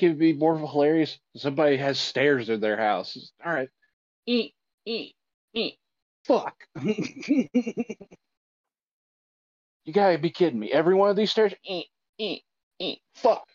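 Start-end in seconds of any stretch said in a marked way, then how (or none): 4.3-6.7 squeaky stair imitation. 11.6-13.5 squeaky stair imitation. Acting out squeaky stairs again.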